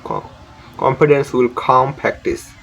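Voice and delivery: ordinary delivery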